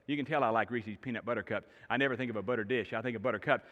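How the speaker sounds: ordinary delivery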